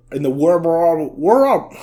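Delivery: war stretched out slowly as a bad Australian wɔɹ; attempted Australian accent. Shown as a demonstration. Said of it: "That's my best".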